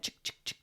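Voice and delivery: soft breathy chick chick of a shaker rattle; imitated rattle sound. Telling a friend how the shaman rattled the chacapa, hushed imitation. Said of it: "like that".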